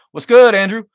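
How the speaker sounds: high, loud hello to a viewer; excited loud greeting. Real, not acted. Greeting a chatter by name.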